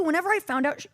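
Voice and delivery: ordinary talk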